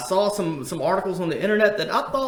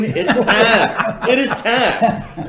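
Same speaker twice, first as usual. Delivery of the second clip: louder, slow, laughing through it; laughing mock announcement; 5 semitones higher, 6 dB louder, much slower than usual. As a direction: boom it out playfully, cracking up.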